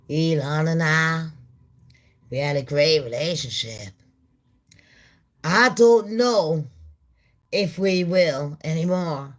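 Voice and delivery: lightly voiced Trump, low and deliberate. Mocking Trump on the Elon split, lightly voiced Trump. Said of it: "And he's like".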